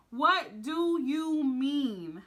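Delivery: What do you mean stretched word by word, slow; very slow drawn out. Retold or imitated. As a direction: drag each word out in disbelief.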